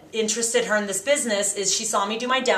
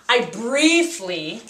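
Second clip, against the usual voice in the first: "briefly" drawn out slowly and weighted; slow, deliberate emphasis on "briefly"; stress on briefly; much slower than usual. Shown as a demonstration. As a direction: lean on "briefly" and slow it right down. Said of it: "and I'm emphasizing briefly".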